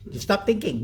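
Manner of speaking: ordinary talk, nothing marked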